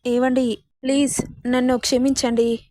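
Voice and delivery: ordinary delivery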